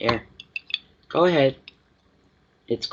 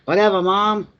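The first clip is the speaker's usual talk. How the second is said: high, louder put-on voice of a bratty kid; sulky dog voice; 7 semitones higher, 4 dB louder than usual. Owner voicing her dog brushing her off, high bratty kid voice. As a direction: voice the dog sassing back to his mom. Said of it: "He says, "Whatever".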